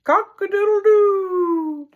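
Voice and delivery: high, loud, slow crowing; rooster crow. Acted. Crowing at the fence.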